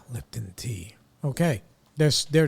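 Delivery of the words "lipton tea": sighing, whispered softer aside; quiet recovering whisper. Real, not acted. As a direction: breathe out and whisper it. Recovering after coughing fit.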